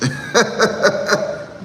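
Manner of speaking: airy, rising laughter; real laugh. Real, not acted. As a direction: laugh at your own joke. Laughing at own pun.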